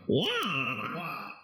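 drawn-out pretend cry, kept soft; mock Batman scream. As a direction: imitate Batman crying out, quietly and high. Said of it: "Yeah, Batman went".

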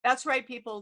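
ordinary talk, nothing marked

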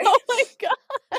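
laughing oh my God; cracking up in shock; laughing disbelief. Real, not acted. Friend sneezed headphones off.

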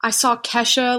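usual speaking voice

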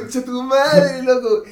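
drawn-out, high, loud exclamation; amazed swearing. Real, not acted. Friend swearing in awe at the improviser, excited. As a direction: stretch the curse out in awe.

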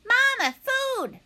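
high, loud, drawn-out cry; high-pitched hungry hatchling voice; tiny gosling voice. A narrator's reading. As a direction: call slowly and insistently like a baby bird. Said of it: "The tiny voice".

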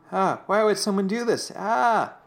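high-pitched wail of distress; hammed-up anguished outcry; mock freak-out. Eugene back at the cemetery, mock panicked wail. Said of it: "Eugene is just freaking out".

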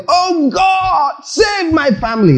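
high, strained shout at God; mock shouted prayer. Shown as a demonstration. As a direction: yell the prayer up to God, mocking it. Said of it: "You cannot make petition shouting".

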